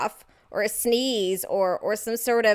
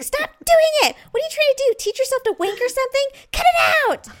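high, loud imitation of a fed-up wife yelling; mock-furious shouting, hammed up; exasperated yelling; 7 dB louder, much faster than usual. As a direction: scold loudly, pitched way up. Acting friend yelling at her husband.

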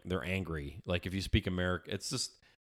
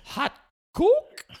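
very high, loud, questioning mimicry; two halting words, squeaking up high at the end; sister-in-law's mimicry; 7 dB louder than usual. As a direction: imitate a foreigner's halting question, playfully. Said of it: "No, she'd go".

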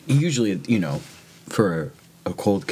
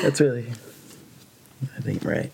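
crying; 5 semitones higher than usual. Real, not acted. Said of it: "I'm sorry, I'm crying".

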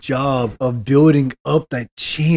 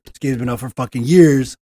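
normal talking voice

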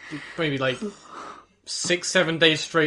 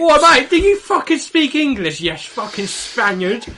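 mock lager lout, higher and louder; mock English lout; 9 semitones higher, 6 dB louder than usual. Mocking an English tourist shouting at a Spaniard, high and loud. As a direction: bark it like a drunk Brit abroad.

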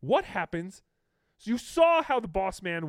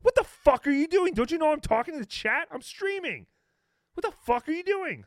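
high, hammed-up furious yelling; mock rage, pitched way up; mock angry yell; 12 semitones higher, 4 dB louder than usual. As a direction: imitate the streamer screaming at his dad. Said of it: "And he's like".

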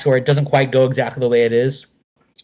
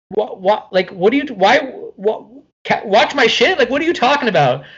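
incredulous outrage; 8 semitones higher than usual. Retold or imitated. Outraged after nearly hit by bass.

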